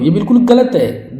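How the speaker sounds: ordinary talk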